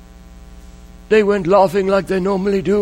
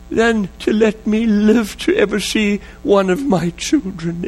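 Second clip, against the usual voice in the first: imitated sobbing plea. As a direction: act out a father sobbing as he begs God. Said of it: "and he sobbed again".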